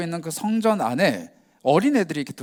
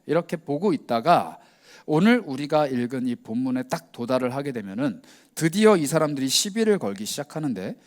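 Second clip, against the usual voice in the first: ordinary delivery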